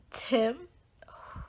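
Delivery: whispered through tears; holding back tears. Real, not acted. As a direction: barely get the name out, near crying. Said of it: "trying to say his name without crying".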